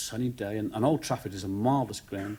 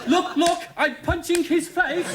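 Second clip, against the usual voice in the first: loud high thrilled yelling; excited shout; 5 dB louder, slower than usual. Retold or imitated. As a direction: cheer like a fan gone wild. Excited friend cheering a tackle.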